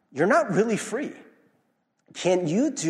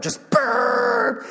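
imitated horn blast. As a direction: blast the horn sound sharply.

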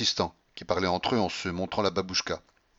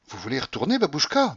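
startled exclamation; 6 semitones higher than usual. A narrator's reading. Alexis questions her return to roulette, startled. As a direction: pitch leaping up at the end. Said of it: "m'écriai-je".

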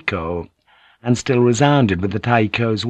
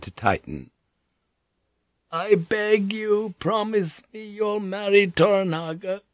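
put-on dying old woman; 10 semitones higher than usual.

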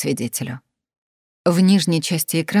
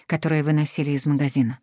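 ordinary delivery, nothing marked

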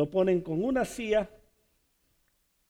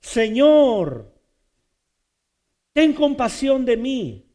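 a raised cry of mercy, higher and louder; loud plea; 7 semitones higher, 7 dB louder, slower than usual. Retold or imitated. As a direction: call out to the Lord for mercy. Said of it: "que hoy usted le diría". (súplica en voz alta)

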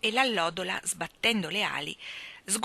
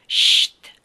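loud hissed shush; sharp shush; 13 dB louder than usual. Acted. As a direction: cut him off with a sharp hiss. Jane silences Michael.

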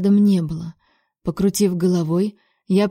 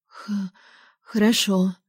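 shaky voice; 4 dB quieter, slower than usual. A narrator's reading. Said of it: "голос мой подрагивал".

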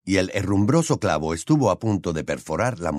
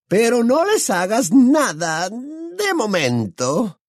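very high, drawn-out villainess voice; shrill, louder, menacing orders; threatening witch; 4 dB louder, slower than usual. A narrator's reading. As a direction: lord it over them in a slow screech. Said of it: "lo amenazó la bruja".

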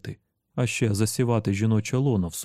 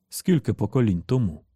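ordinary delivery

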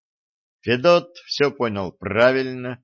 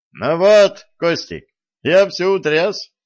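bright, much higher and louder; cheerful; 8 semitones higher, 6 dB louder than usual. A narrator's reading. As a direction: cheer it out, delighted with yourself. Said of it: "весело сказал Виталик".

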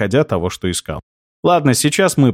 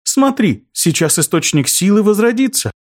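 very high excited chirp; chirpy creature voice; 8 semitones higher than usual. Acted. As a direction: chirp it out eagerly. Ptyuch continues speaking.

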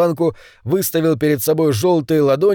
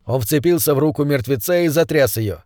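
ordinary delivery, nothing marked

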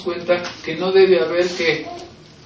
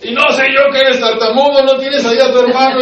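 Moses protesting; 7 semitones higher, 7 dB louder than usual. Retold or imitated. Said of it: "Así como Moisés".

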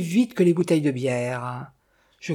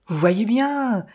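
slow, louder, eager exclamation; triumphant cry; 6 semitones higher, 4 dB louder, slower than usual. A narrator's reading. The narrator cries out to the innkeeper, slow triumphant exclamation. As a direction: drive it home, thrilled to be right. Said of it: "criai-je les yeux brillants".

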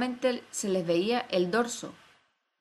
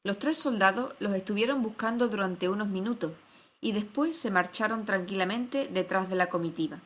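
ordinary delivery, nothing marked

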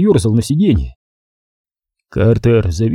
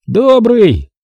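forced friendliness; 11 semitones higher, 4 dB louder than usual. A narrator's reading. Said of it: "пытаясь выдавить из себя улыбку".

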